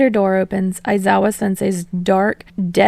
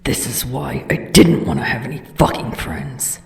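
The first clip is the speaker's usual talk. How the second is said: gruff grumbling voice; 6 semitones lower than usual. Acted. Bakugo grumbling.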